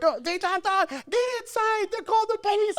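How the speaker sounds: very high, panicky mom voice; mock screaming mom. Mom calling the kid inside from police, mock screaming. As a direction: shriek a frantic warning in high pitch. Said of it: "the mom screaming through the screen window".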